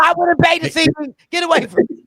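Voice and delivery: loud, sassy retort; half-laughing sassy put-down; mock snap. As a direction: brush the fan off loudly. Telling a fan off.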